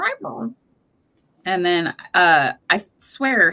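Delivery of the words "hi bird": high, soft talking-bird voice; little high-pitched bird mimicry; Spanky. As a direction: imitate a bird's clumsy attempt at words. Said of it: "he's not very good at it".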